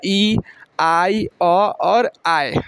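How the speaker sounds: आई, अ and आय drawn out slowly; slow teacher's vowel list; slow listing of sounds. Shown as a demonstration. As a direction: lay out each vowel sound slowly for the class. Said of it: "आई का देखिए उच्चारण".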